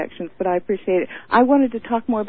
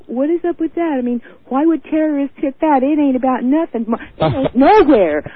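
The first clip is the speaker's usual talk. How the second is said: mock dismissive local; 5 semitones higher, 5 dB louder than usual. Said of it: "I heard somebody say".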